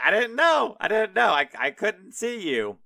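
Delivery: high, loud, hurried excuse-making; imitated flustered yelping, easing off at the end; mock frantic protest. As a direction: plead innocence in hammed-up panic. Rider pleading with the angry angel.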